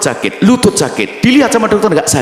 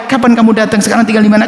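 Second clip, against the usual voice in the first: friendly greeting; 10 semitones higher, much faster than usual. Retold or imitated. Polite alternative greeting voiced.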